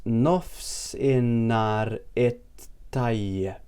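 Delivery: Nofsinhar it Tayyip very slowly, syllable by syllable; slow demonstration. Shown as a demonstration. Teacher breaks down good afternoon for learners, very slow syllables. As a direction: stretch it out, pausing before Tayyip.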